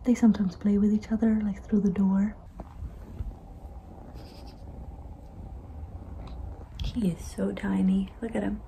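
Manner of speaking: soft whisper; hush, keep it to a whisper. Real, not acted. Whispering near sleeping kitten.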